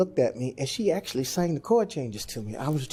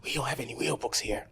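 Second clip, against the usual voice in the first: softer, quick concerned aside; Larry Monroe checking quietly from the wings; hushed from the wings; 8 dB quieter, faster than usual. Retold or imitated. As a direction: murmur it from offstage. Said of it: "He says".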